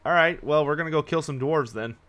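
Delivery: ordinary talk, nothing marked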